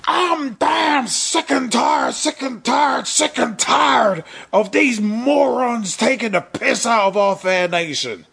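shouted wrestling-promo rant, high and slow; furious ringside shout; Stone Cold rant. Retold or imitated. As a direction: bellow it like a wrestling boss. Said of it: "paraphrasing Vince McMahon about Stone Cold Steve Austin".

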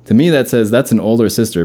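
normal talking voice

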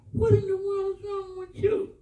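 high, crying-out question; astonished. Retold or imitated. Husband confronts his wife at the door, astonished. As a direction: exclaim it in disbelief.

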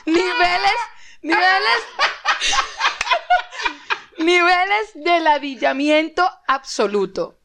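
slow, laughing emphasis on each word; emphatic exasperation. Real, not acted. Cohost recounting a pointless meeting, emphatically exasperated.

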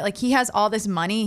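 normal talking voice

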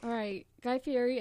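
ordinary delivery

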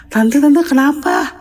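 high, rushed, panicky apologizing; alarmed, high-pitched "aduh, maafin"; flustered panic. Retold or imitated. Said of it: "Kaget banget kan?".

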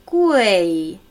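Gui slow with the stress on u. Shown as a demonstration. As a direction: draw out Gui, weight on the u. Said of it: "die Betonung liegt hier auf U". (langsam: Gui, Betonung auf U)

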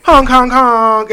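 slow, loud, high honks; slow honking sound effect. Shown as a demonstration. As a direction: drag out each honk. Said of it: "I'm pressing the sound effect button".

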